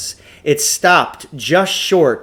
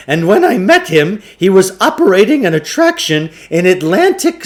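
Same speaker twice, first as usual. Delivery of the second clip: higher, louder breathless showman; excited huckster; 7 semitones higher, 7 dB louder than usual. A narrator's reading. Shopkeeper bragging about his Tibetan machine, high excited pitch. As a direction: spin a wild tale like a carnival barker. Klugarsh voiced in story.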